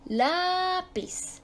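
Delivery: "lápiz" with a long LÁ, pitched well up; stress on LÁ. Shown as a demonstration. Teacher repeating the tonic syllable example, stress on LÁ. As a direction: stretch LÁ high in "lápiz". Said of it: "ahí cae la sílaba tónica".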